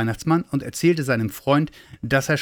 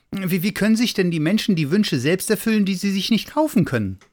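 high, flustered little figure; stammering high-voiced question; puzzled little Santa; 9 semitones higher than usual. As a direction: ask it anxiously, pitched high.

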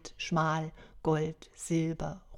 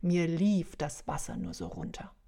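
ordinary delivery, nothing marked

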